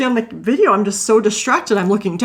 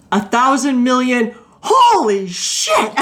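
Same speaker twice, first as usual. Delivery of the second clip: high, slow shocked exclamation; stunned outburst; 6 semitones higher, slower than usual. Retold or imitated. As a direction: blurt it at the sight of the ring. Sees the ring, blurts curse.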